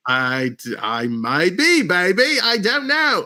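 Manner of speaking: hammed-up comic accent, swooping high at the end; playful movie-spoof voice, pitch leaping up on the last words; Mike Myers. Host playing along with co-host's teasing, Mike Myers impression. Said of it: "Are you turning into Mike Myers?".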